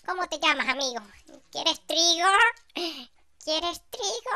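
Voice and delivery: voz aguda y exagerada; ofrécele trigo con tono muy alto; hablando a animal. Pretend. (pregunta juguetona en agudo)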